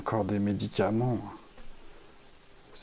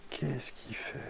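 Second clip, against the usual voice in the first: hushed; 3 semitones higher, 13 dB quieter, slower than usual. Real, not acted. Stranger circling his car.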